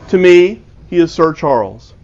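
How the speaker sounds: usual speaking voice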